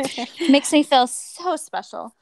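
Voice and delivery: slow, drawn-out sarcasm; dripping irony, slowly; slow sarcastic so special. Real, not acted. Sarcastic remark on her case.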